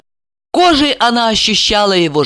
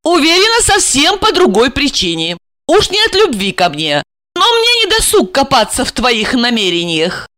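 higher-pitched, dismissive woman; scornful wife; 6 semitones higher than usual. Acted. Wife doubts her husband's motives, scornful. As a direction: brush his excuses aside.

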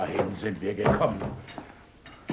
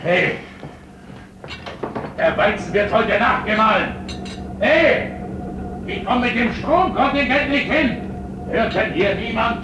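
louder and higher, yelling orders; angry shouting; 11 semitones higher, 6 dB louder than usual. Acted. Miller shouting at his workers, furious. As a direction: bellow so they hear you over the mill.

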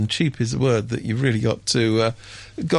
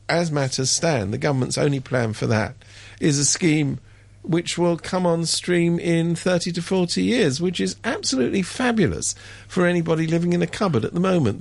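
normal talking voice

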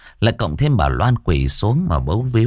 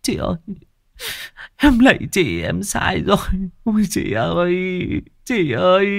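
high, tearful wail; sobbing; 11 semitones higher than usual. Acted. Younger sister at her dead sister's altar, sobbing remorsefully. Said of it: "bật khóc thút thít".

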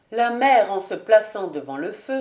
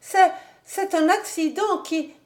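very high, stammering excuse; frightened wife explaining herself; nervous excuse; 10 semitones higher, 3 dB louder than usual. A narrator's reading. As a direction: falter anxiously, high-voiced.

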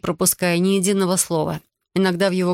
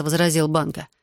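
ordinary delivery

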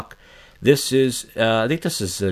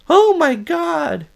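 much higher, very slow mock horror; mock shocked gasp; 4 dB louder, much slower than usual. Mocking the stunned room at the meeting, drawn-out shocked gasp. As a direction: stretch out a scandalised gasp. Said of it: "everyone's like".

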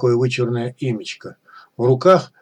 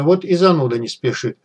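usual speaking voice

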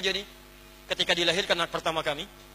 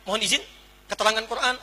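ordinary talk, nothing marked

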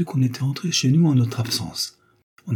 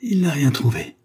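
triumphant joy; 6 semitones higher than usual. A narrator's reading. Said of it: "un éclair de joie triomphante".

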